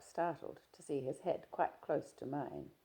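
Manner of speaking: ordinary delivery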